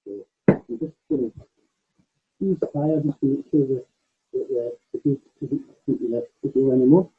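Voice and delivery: muffled, as if through a hand; talking with a hand over the mouth. Real, not acted. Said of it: "sound's gone a bit muffled again there".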